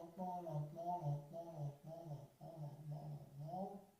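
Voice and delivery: soothing fade from soft to barely audible; fading to a hush. Shown as a demonstration. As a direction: fade out gently as the breath runs out. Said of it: "contrôlez le mouvement d'expire".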